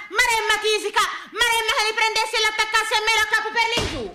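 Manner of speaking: comic Tuscan grandfather ranting, pitch climbing; grandpa cursing. Acted. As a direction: rattle off curses like an outraged old farmer. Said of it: "Eh, nonno!".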